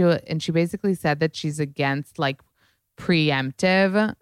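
normal talking voice

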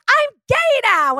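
high, slow, drawn-out proclamation; camp, high-pitched reveal; flamboyant announcement. Retold or imitated. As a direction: imitate a flamboyant guy proudly announcing himself. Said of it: "flamboyant beyond".

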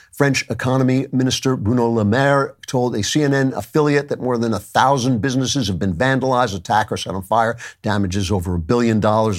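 ordinary talk

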